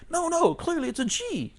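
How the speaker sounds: high-pitched mock objector; contradicting. Second voice arguing back.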